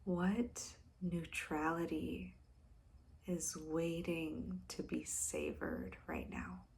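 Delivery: soft, slow and deliberate, with pauses; hushed, unhurried meditative question; slow contemplative reading. Real, not acted. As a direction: pose the question quietly and let it linger. Reads out the channelled question.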